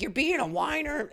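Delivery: a high mock mom voice, dismissive; imitating mom. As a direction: brush him off in a high put-on mom voice. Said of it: "She's like".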